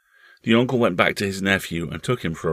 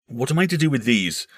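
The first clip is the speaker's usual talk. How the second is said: higher, animated question; crying out; 7 semitones higher, 3 dB louder than usual. Acted. Uncle waving winnings before the boy, crying out. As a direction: exclaim it to the boy. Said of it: "he cried".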